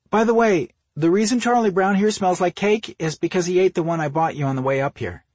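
high, loud sister breaking news, easing down toward the end; sister character's voice. Acted. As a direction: pipe up high and emphatic as the sister. Voices Ruth, the sister, in dialogue.

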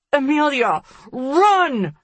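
high, loud cry to run; yelling. A narrator's reading. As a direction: shout a desperate warning. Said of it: "I yell".

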